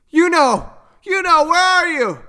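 high, loud searching call. A narrator's reading. Mother calls Yuno from behind the bushes, high calling voice. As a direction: call for a lost child from afar.

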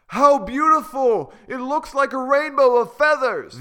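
high, loud delighted cry; raving admiration. Acted. Hippos raving at the parade of feathers, high and delighted. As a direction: gasp in awe at the colors. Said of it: "The hippos are raving".

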